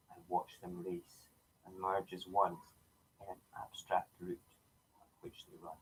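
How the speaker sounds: poem reading. Acted. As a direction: read the poem gently to the viewers. Reads his own poem aloud.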